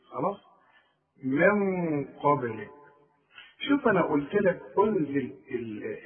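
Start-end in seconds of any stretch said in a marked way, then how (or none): 1.2-2.7 من قبلك with a full, heavy nasal before the قاف, slow; heavy ghunna. Shown as a demonstration. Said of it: "الغنة هنا مفخمة". (غنة مفخمة ببطء في من قبلك)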